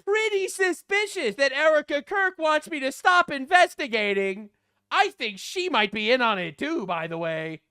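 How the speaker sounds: high, loud mock Candace voice; hammed-up suspicious woman; imitating Candace. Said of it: "she says".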